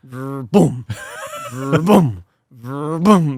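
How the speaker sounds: low vrr, then a thudding boom; vocal engine-and-thump sound effect; imitated car clunking. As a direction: mimic a lurching car with repeated booms. Imitates car driving on the boot.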